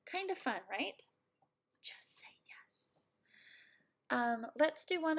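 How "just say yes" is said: hushed joking aside; whisper. Acted. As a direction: whisper it like a shared secret. Playful aside to viewers.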